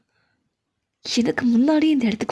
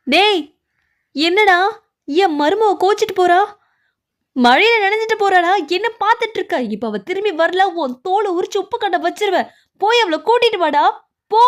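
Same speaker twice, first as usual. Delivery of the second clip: high-pitched parental telling-off; angry scolding; 5 semitones higher than usual. Acted. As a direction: scold your son and order him out, voice raised high. Parent scolds son, threatens him. (கோபமாக உயர்ந்த குரலில் திட்டுவது)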